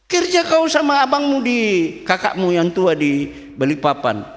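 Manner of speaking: retold angry order, pitched high at first; angry uncle. Uncle ordering nephew off to work with his brother, mildly angry. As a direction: imitate an uncle's annoyed command. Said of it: "saking marahnya dia kasih saya S K".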